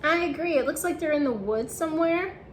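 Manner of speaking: plain reading voice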